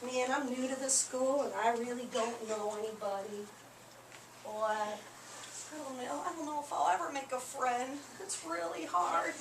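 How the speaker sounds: soft, hesitant and low-key; pretend shy. Teacher playing a new pupil without friends, shy. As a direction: act timid, shrinking from notice.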